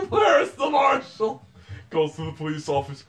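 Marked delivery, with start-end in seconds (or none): 0.1-1.5 high, loud, slow hammed-up shout; mock call for the marshal. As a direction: bellow for the lawman like a scandalized townsman. Mock cowboy calling for marshal.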